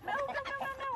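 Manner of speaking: rising alarm, high, no no no; urgent high coaxing. Real, not acted. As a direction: hurry to stop the chicken. Chicken pushing head through net.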